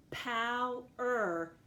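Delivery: "power" broken into two beats, pow and er; "power" as pow, short gap, er; split by syllable. Shown as a demonstration. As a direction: say "power" slowly, one syllable at a time. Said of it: "has two syllables".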